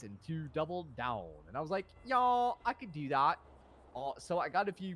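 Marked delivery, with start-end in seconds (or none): 2.0-3.4 high, loud, drawn-out yes; hyped agreement. Retold or imitated. Man taking officers' dating advice, high hyped agreement.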